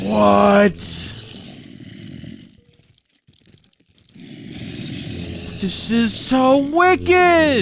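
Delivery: high, loud and drawn out, leaning into "wicked"; amazed. Real, not acted. Player gushing over alien tech, high and thrilled. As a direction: gush with wide-eyed wonder.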